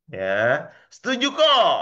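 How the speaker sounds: high, loud chiming-in; eager agreement. Acted. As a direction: call out agreement like an eager audience. Voices the audience agreeing loudly.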